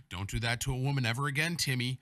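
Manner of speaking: loud, stern mock warning; mock scolding. Retelling the girl's warning to Timmy, loud mock scolding. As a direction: lay down the law loudly as the older girl. Retold older girl scolds him.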